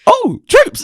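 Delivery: very high mock fan shout; excited fan recognition. Fans recognising him when the mask drops, excited. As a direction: squeal it loudly like a starstruck fan.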